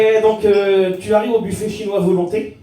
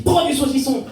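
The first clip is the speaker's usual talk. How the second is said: higher-pitched insistent demon; second demon; 8 semitones higher than usual. Acted. Second demon urging a dieter, high and insistent. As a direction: press the order like a pushy little devil. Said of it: "un autre démon qui te dit".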